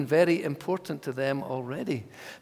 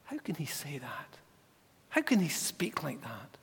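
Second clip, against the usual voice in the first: breathy, hushed question; whispered doubt; 3 semitones lower, 7 dB quieter than usual. Acted. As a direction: whisper it like a scandalized murmur. Teachers' doubts voiced.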